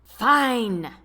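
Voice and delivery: relenting hotly. A narrator's reading. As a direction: give up the point, still burning. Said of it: "Robert relented hotly".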